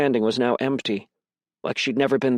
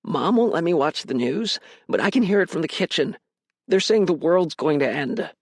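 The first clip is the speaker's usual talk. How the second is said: put-on high-pitched worried little boy; scared child; 5 semitones higher than usual. A narrator's reading. Little brother telling what the news says, scared. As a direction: report it like a frightened kid, pitched up. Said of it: "His voice quivered".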